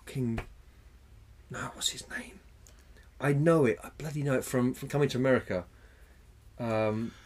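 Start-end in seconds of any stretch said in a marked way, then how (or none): 1.5-2.4 whisper. Real, not acted. Whispered to self.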